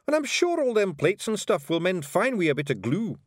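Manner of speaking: high, breezy Scots reassurance; cheerful Scottish Feegle. A narrator's reading. Rob telling Miss Level the broken plates will mend, cheerful. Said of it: "said Rob Anybody cheerfully".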